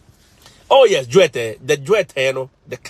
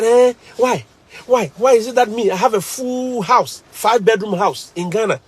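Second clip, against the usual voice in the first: higher, whiny, wailing complaint; crying baby; 4 semitones higher than usual.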